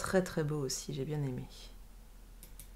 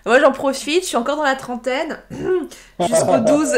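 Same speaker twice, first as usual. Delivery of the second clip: laughing; 7 semitones higher, 14 dB louder than usual. Real, not acted. Joking about age, laughing.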